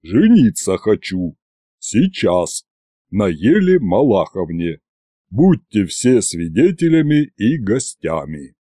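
deep, deliberate announcement; low-pitched groom addressing the crowd; bass-voiced duke. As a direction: declare it low, in short separate phrases.

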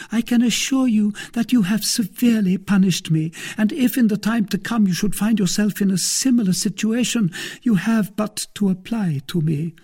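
an eager wife pressing her case; lawyer's wife voiced high. A narrator's reading.

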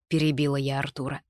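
ordinary delivery, nothing marked

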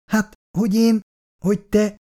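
high, halting, unsure reply; flustered, groping for an argument; stammering. A narrator's reading. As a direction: falter between broken phrases. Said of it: "dadogni kezdett".

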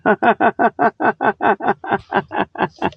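long run of real, helpless chuckling; cracking himself up. Real, not acted. Host cracks himself up over the muffin, laughing. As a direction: laugh steadily at your own joke, pulse after pulse. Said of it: "I crack myself up sometimes".

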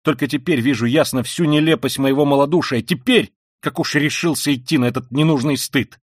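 loud and high furious outpouring; raging tirade. A narrator's reading. Raskolnikov raging at his sister about the crime. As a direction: spit it out in a fury. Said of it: "в каком-то внезапном бешенстве".

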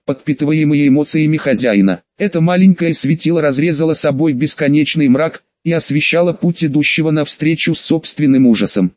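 ordinary delivery, nothing marked

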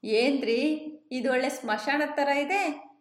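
joking. A narrator's reading. Driver jokingly asking the hitchhiker if he lives by a graveyard. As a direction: tease him lightly about the graveyard. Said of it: "ತಮಾಷೆಗೆಂದು ಕೇಳಿದೆ".

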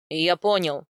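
low, loud male bark; barked retort. A narrator's reading. Husband cutting off his pleading wife, barking. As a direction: cut them off with a bark. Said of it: "рявкнул он".

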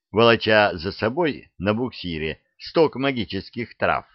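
ordinary delivery, nothing marked

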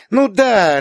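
put-on high, loud child's voice; small girl stating the obvious; emphatic little girl. A narrator's reading. Little girl confirms it to her aunt, emphatic.

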